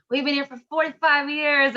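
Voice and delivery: put-on old man behind the counter, beaming; light old-guy voice, proud; proud old deli guy. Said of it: "his face lights up and he goes".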